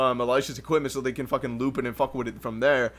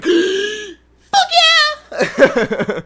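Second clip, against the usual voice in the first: very high, loud burst with a laugh; excited yell; 7 dB louder than usual. Retold or imitated. Re-enacting saying yes to the band's invite, a high laughing whoop. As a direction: blurt it out like a thrilled fanboy. Invited along by his idols.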